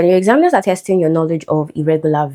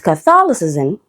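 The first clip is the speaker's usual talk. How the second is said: "Catholicism" with a schwa first and stress on THOL; second-syllable stress. Shown as a demonstration. Teacher explaining the stress shift, second-syllable stress. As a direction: reduce the first vowel of "Catholicism" and hit THOL. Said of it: "the stress shifts".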